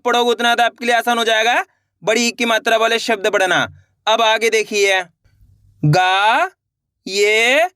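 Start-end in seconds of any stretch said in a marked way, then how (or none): 5.8-7.7 "गा", pause, "ये", each held long; letter by letter. Shown as a demonstration. Teacher models the method, drawn-out separate "गा", "ये". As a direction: sound out "गा" and "ये" separately. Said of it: "एक एक अक्षर का उच्चारण करके".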